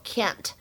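can't with a flat short a as in cat, American style. Shown as a demonstration. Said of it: "而在美式英语当中呢，读作". (美式发音的can't)

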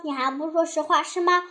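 ordinary delivery

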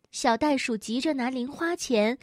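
plain reading voice

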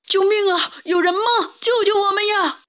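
high, panicked cries; wailing for help. A narrator's reading. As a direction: call desperately for rescue. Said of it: "三个动物嚎啕大哭起来".

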